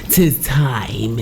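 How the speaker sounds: whispery, slow witch chant; stage witch incantation. Acted. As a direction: whisper the spell with drawn-out menace. Actors rehearsing the witches' chant.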